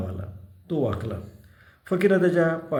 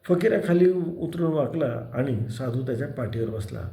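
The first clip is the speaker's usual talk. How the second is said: ordinary delivery, nothing marked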